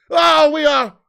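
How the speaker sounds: ordinary talk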